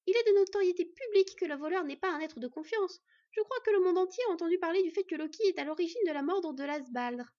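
brisk, knowing housewife; Beyla's high voice. A narrator's reading. Beyla guessing at Odin's motives, high and lively.

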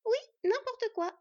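put-on high, loud child's voice backing her up; little sister chiming in. A narrator's reading. Scad backing her sister against the hunter, little girl chiming in. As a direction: pipe up loudly in agreement, very high. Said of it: "appuya Scad".

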